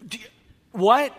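a sudden high, loud "what?" in disbelief; shocked what. Retold or imitated. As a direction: act out total disbelief at two hours of prayer. Mock shocked reply to prayer boast.

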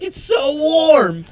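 high, loud, drawn-out exclaiming; mock elated shout. Host voicing the naked man in snowy Moscow, mock elated. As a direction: cry it out as the naked passenger, overjoyed. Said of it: "and he's like".

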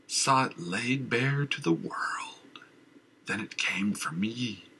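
slow hushed whisper; madman whisper. Acted. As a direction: whisper it like a secret terror.

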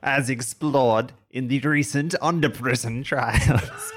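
slow, loud, high alien voice; alien villain voice. Acted. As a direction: declare it like a gloating alien villain. GM continues the villain's broadcast.

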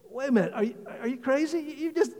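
high-pitched disbelief, stumbling over the words; incredulous protest. Acted. Preacher to unforgiving servant over the hundred, incredulous.

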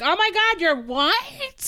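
high squealing fake surprise; mock shock. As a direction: gasp it out like a shocked comic.

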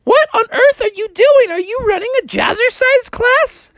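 very high, bubbly mother; a mom thrilled by a dance class; squeaky high excited mom. Acted. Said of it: "Froggy's mom woke up and was like".

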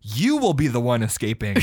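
hammed-up villain comeback, punched opening word; villain's mock yell. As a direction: throw it back like a cartoon villain. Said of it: "he yells back at you".

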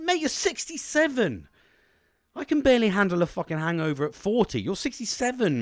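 high, exasperated retold scolding; incredulous telling-off. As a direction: tell off your old dad in disbelief. Retold scolding of drinking dad.

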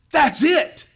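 high, loud burst of realization; excited discovery. Retold or imitated. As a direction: exclaim your sudden discovery. Retold shouted moment of discovery.

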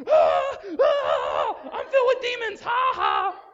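very loud, high manic yelling; crazed shout. Acted. Possessed man runs around the graveyard, manic yelling. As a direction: rant like a madman. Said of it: "running around all the time like".

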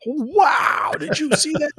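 high mock gasp of wonder; hammed-up Cockney-free awe, high and bright-eyed; amazed viewer. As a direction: gush like a dazzled old-time viewer. 1960s audience amazed by effect.

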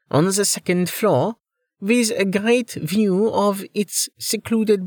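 put-on high-pitched accented sales patter; high, lilting estate-agent voice with an accent; chatty realtor. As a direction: play a foreign-accented realtor giving a measured tour. Realtor character giving a house tour.

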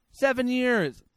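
SEVEN punched high and loud in disbelief. Real, not acted. Repeating in disbelief.

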